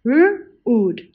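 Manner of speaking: R-ood split into onset and long vowel; segmented. Shown as a demonstration. Teacher sounding out R-ood for the class. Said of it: "Say it with me".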